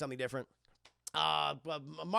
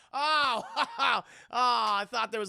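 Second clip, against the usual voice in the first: higher, louder relieved "oh"; relieved reaction; 6 semitones higher, 8 dB louder, slower than usual. Retold or imitated. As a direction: let the scare go out loud. Girl sees it was a poster.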